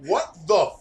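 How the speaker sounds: real disbelief at what you see; shocked outburst. Real, not acted. Shocked at the game screen.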